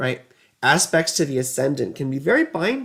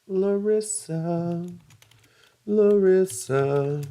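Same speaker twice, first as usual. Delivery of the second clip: slow, stretched Larissa; drawn-out name; 3 semitones higher, much slower than usual. Acted. Calling out to Larissa, slow and stretched. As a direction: draw the name out slowly. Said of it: "calling the listener's name".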